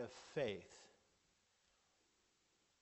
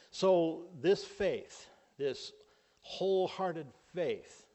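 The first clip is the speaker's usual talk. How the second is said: slow, loud, higher and drawn out; emphatic preaching; 5 semitones higher, 7 dB louder, slower than usual. Real, not acted. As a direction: hammer home this faith. Preacher building to emphatic point.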